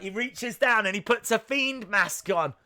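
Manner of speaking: ordinary delivery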